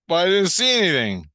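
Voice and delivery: loud, high-pitched objection falling away; exasperated retold protest. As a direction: complain as if short-changed. Retold protest at being told passed.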